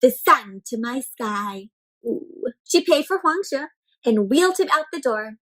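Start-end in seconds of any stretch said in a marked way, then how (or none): none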